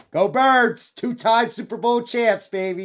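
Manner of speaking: loud, high cheering for the Birds; excited Eagles fan shouting his team's win. Real, not acted. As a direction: yell it like a fan in the stands.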